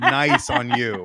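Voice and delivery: high, slow words through laughter; laughing. Real, not acted. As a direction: giggle the words out. Laughing at reading erotica.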